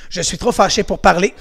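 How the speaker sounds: ordinary talk, nothing marked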